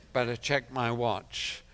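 normal talking voice

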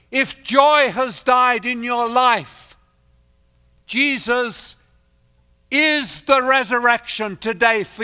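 loud, high, ringing sermon voice; rising pulpit proclamation; full-force preaching. Acted. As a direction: preach it out to the whole hall.

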